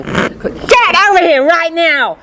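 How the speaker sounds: high, slow mock yell; owner's command. Owner ordering dog back at the park, high mock yell. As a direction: stretch out an exasperated order. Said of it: "owner yelling at dog".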